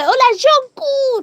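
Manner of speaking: high, drawn-out fan call; imagine hailing an idol across a distance; calling from afar. Pretend. Fan greeting [name] to get corrected, playful high call. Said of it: "yo le voy a gritar desde lejos".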